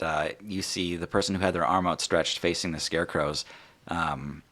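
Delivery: ordinary delivery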